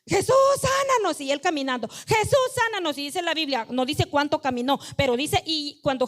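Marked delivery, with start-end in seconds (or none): 0.1-1.2 high, pleading call of "Jesús, sánanos"; crying out. Retold or imitated. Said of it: "le dicen". 1.9-3.0 high, pleading call of "Jesús, sánanos"; crying out. Retold or imitated. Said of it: "le dicen".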